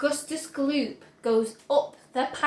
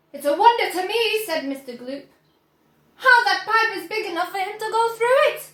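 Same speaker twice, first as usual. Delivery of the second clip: Mr Gloop; 5 semitones higher, 5 dB louder, faster than usual. A narrator's reading.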